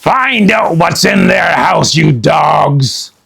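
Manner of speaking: loud shouted pirate order; Captain Bones ordering his men; barking pirate captain. Acted. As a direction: bark orders at the crew.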